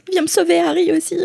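swooning fan. Pretend. Joining the fangirl cheer.

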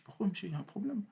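small, high, very soft voice; meek retold question. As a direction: play innocent to an officer, high and hushed. Acts himself questioning the gendarme.

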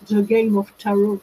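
self-mocking French-accented English title. Shown as a demonstration. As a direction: give the English book title with your own French accent, laughing at yourself. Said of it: "avec mon accent subi".

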